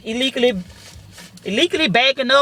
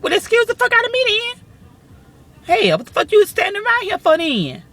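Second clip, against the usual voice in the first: high, sassy comeback; offended outburst; indignant snap; 11 semitones higher than usual. Retold or imitated. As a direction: tell him off in disbelief. Snapping back at the witness.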